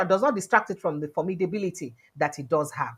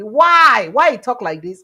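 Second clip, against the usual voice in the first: incredulous; 3 semitones higher, 8 dB louder, slower than usual. Real, not acted. Host demanding of viewers why the chieftain said it, loud disbelief. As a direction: challenge the claim out loud.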